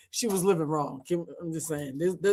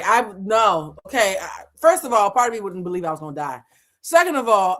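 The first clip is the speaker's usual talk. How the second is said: ordinary talk, nothing marked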